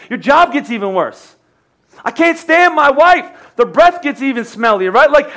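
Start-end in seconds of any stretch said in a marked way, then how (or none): 2.0-3.3 higher, drawn-out petulant gripe; hammed-up grumble about the wife; mock whine. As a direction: play a sulking husband for laughs. Pastor mocks a complainer.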